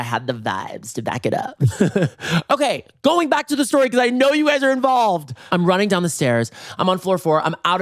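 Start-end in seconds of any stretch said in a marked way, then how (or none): 2.5-5.3 high, hammed-up sing-out; theatrical announcement. Real, not acted. Storyteller calling listeners back to the story, high and theatrical. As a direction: sing it out high to the hooked audience.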